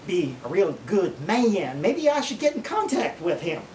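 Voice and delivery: admiring drawl with stretched vowels; imagined corn-fed mama. Acted. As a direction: swoon slowly like a smitten country mama. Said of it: "some corn-fed mamas".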